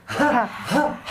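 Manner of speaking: "Ja" as a breathy Arabic ḥ from the throat; throaty exhaled "Ja", like breathing on glass; fogging the glass. Shown as a demonstration. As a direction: huff "Ja" as if misting a pane to clean it. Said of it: "Limpia el cristal".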